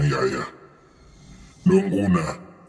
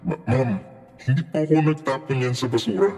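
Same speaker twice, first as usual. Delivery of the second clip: high-pitched, stammering denial; small high voice of a flustered servant; timid maid; 9 semitones higher than usual. A narrator's reading. Maid denying to her angry employer, nervous.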